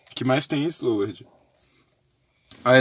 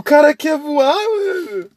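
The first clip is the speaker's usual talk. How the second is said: excited; 12 semitones higher, 8 dB louder, slower than usual. Real, not acted. Excited about jumping character.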